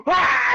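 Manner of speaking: loud mock gasp, then high shriek; imitated gasp and scream. Teacher seeing kids fight, gasping then screaming. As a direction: act out the appalled teacher's scream.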